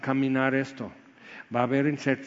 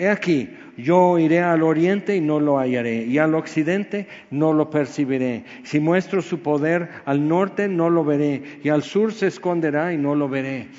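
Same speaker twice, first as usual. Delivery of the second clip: loud preaching read; emphatic scripture reading; 5 dB louder than usual. Acted. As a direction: proclaim each direction with force. Reads Job's search aloud. (lectura bíblica enfática)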